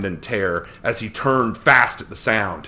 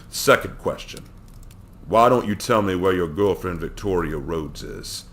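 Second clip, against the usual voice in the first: ordinary delivery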